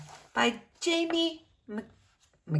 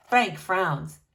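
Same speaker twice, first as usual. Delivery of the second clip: ordinary delivery, nothing marked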